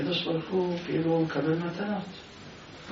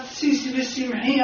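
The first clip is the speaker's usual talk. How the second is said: joyful call; 9 semitones higher, slower than usual. Acted. Husband announces joy to wife.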